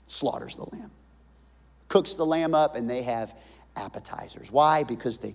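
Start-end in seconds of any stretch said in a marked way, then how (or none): 0.1-0.9 whispered repeat. Shown as a demonstration.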